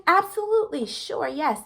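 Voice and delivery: keen people-pleaser yes; over-eager yes. Retold or imitated. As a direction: say yes before they finish asking. Eagerly agreeing to scan tomorrow.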